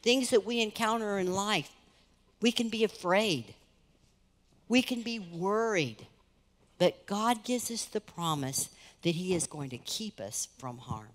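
ordinary talk

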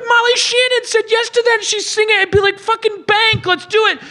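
high-pitched, eager outburst; excited urging. Acted. Telling Santa his date said yes, excited urging. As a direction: push him to seize the chance.

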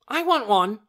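put-on high, loud, demanding child; excited cry. A narrator's reading. Torin demands an egg from the doctor, excited cry. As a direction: cry out greedily at a high pitch. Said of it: "he cried".

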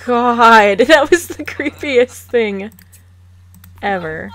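half-laughing, creeped out by the Sim; God, that was so creepy; loud creeped-out exclaim. Real, not acted.

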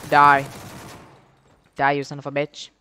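ordinary talk

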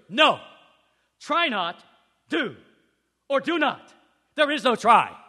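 loud, emphatic Yoda voice; forceful Yoda. Retold or imitated. Yoda firmly corrects Luke, loud and emphatic. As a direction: hammer each word like a stern master. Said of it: "Yoda goes".

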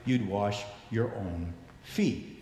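usual speaking voice

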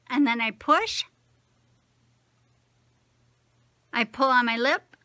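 pressing in dentures; fingers in mouth. Real, not acted. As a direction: lip pulled by fingers. Seen 2: Thumbs inside mouth pushing denture, then fingers pulling lip.

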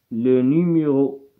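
Le numéro drawn out very slowly; careful teacher dictation of Le numéro. Shown as a demonstration. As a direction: say Le numéro syllable by syllable. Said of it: "slow dictation word".

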